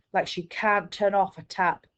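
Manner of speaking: ordinary delivery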